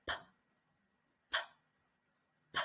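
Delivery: "P" as a bare puff of air, no voice; voiceless p. Shown as a demonstration. Teacher showing how to release air for P. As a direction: release air from closed lips on "p". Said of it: "then we just release the air".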